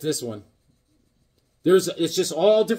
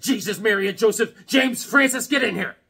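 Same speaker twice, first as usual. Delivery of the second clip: scolding mom; 6 semitones higher, 3 dB louder than usual. Retold or imitated. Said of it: "She said".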